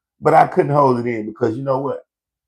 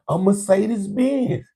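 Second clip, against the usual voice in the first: incredulous; 10 semitones higher, much slower than usual. Real, not acted. Mocking the dumb getaway car.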